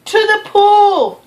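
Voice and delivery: loud, high, slow yell; fireman's yell. Acted. Fireman shouting where to take the fish, loud and high. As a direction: bellow the order. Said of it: "yelled the fireman".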